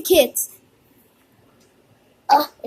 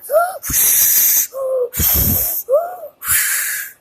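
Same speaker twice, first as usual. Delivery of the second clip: big high gasps and long blown breaths; CPR breaths; 10 semitones higher than usual. Pretend. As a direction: mimic rescue breathing noisily. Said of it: "I'll give her C P R".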